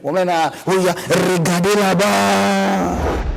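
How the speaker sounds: long, dragged-out mock cry; drawn-out exclamation. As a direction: stretch each syllable out very slowly.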